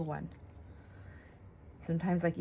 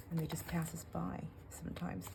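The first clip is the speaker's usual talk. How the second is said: whisper; 11 dB quieter than usual. Real, not acted. Emotional reflection on fleeting moments.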